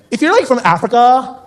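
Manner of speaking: put-on high, airy surfer girl voice; American girl. Beach girl asking the comedian a question, high and airy. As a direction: play the ditzy beach girl. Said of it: "and she goes".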